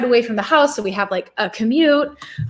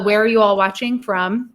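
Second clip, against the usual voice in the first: ordinary talk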